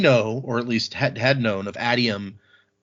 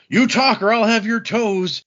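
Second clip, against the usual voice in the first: high, slow menacing growl of a threat; slowed, high-pitched mock menace; mock tough threat; 11 semitones higher, 5 dB louder, slower than usual. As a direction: play the hard-case interrogator, hammed up. [name] playing tough interrogator.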